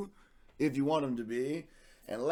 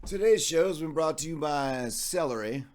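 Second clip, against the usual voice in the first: ad read; 5 dB louder than usual. Acted. Fake sponsor ad read.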